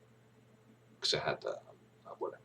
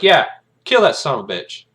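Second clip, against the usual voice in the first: loud, raised-pitch confirmation; mock shouted order; 9 semitones higher, 12 dB louder than usual. Commander confirming the target to a soldier, mock shouted order. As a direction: call it back hard and high.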